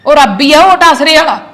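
fervent loud protest; loud insistence. Acted. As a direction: cry out that God did it. Wife insists God did it.

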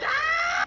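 high startled scream. Real, not acted. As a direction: shriek at the jump scare. Jump scare.

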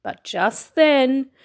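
ordinary delivery

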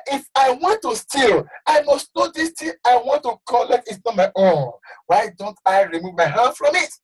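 normal talking voice

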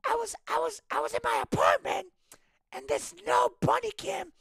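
very high, thin falsetto impersonation; Lewis. Retold or imitated. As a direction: confide eagerly in a squeaky-high voice. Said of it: "a really high-pitched voice".

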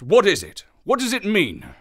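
startled, urgent gasp at a terrible cry; alarmed gasp. A narrator's reading. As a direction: blurt it out in sudden alarm. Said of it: "I gasped".

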